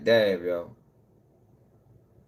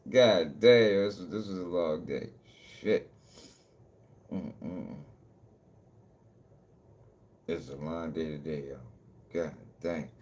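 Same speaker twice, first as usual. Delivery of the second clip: much lower and much softer, drained and tired; weary mumble; 10 semitones lower, 8 dB quieter, slower than usual. Real, not acted. Vlogger signing off after a long day, drained low mumble. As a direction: sink into the words like the day wore you out.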